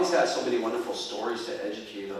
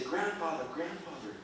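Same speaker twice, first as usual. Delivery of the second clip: soft, hushed call; lightly voiced worried grandchildren; children calling grandfather; 4 semitones higher, 7 dB quieter than usual. A narrator's reading. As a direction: call softly, voice dropping away. Frightened children calling their grandfather softly.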